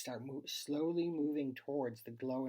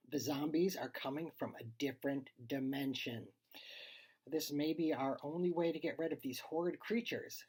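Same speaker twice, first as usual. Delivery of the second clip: ordinary delivery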